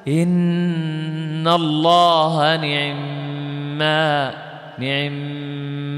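slow chant, نعم as ni-'i-mma with a fleeting i on ayn; ikhtilas recitation. Shown as a demonstration. Teacher demonstrating ikhtilas, very slow recitation. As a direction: chant it, snatching the ayn vowel of نعم before the long m. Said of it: "الاختلاس تقول".

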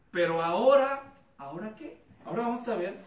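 a sudden loud "ahora" in the sermon; emphatic shout. Real, not acted. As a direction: throw out "ahora" loud and strong. Preacher's emphatic turn. ("Pero ahora" gritado con énfasis)

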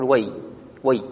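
وي cut off as a word alone, way; stop after wai. Shown as a demonstration. Teacher showing one readers' stop, stop after wai. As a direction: say وي and stop before the kaf. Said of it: "فمنهم من يقف يقول".

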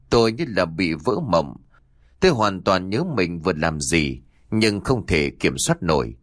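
plain reading voice

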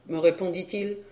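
plain reading voice

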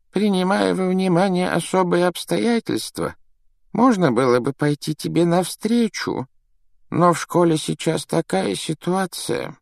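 put-on high-pitched, regretful old man; frail old headmaster, explaining gently; sympathetic old headmaster. A narrator's reading. Headmaster weighs the boy's special circumstances, sympathetically.

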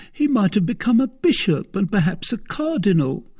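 high-pitched, tearful complaint; almost weeping. A narrator's reading. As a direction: lament it on the verge of tears. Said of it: "almost weeping".

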